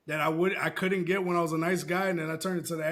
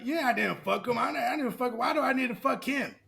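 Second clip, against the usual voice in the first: lightly raised, mock-defensive voice; a hint of a higher, indignant girl; defensive denial; 7 semitones higher than usual. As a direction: friend denying it, mildly higher and defensive. Friend denying in argument.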